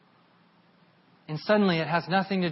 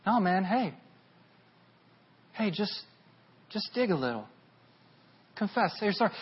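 gentle urging; 3 semitones higher than usual. Retold or imitated.

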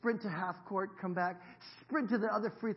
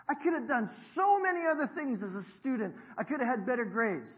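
could punched higher and louder each time; stress on could; 6 semitones higher, 6 dB louder than usual. Shown as a demonstration. Pastor to congregation, hitting could hard. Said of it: "I stress the word could".

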